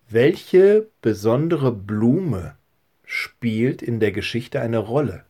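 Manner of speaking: slow, deliberate, drawn-out words; very slow question. Real, not acted. Pastor gives children the second quiz question, slowly and deliberately. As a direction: pose it slowly so it can be remembered.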